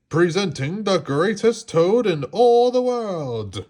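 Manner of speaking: high, loud far-off announcer; strange announcer voice. A narrator's reading. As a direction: announce it like a distant stage voice. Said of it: "A strange voice from far away said".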